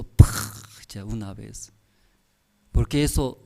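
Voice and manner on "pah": sharp breathy pah; punch sound. Retold or imitated. Preacher imitating a husband's blow. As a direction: slap the sound out. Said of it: "Si su esposo".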